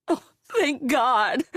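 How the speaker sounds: slow, high, loud sob of relief; sobbing. Acted. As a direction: cry it out slowly, voice breaking. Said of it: "another mom sobbed".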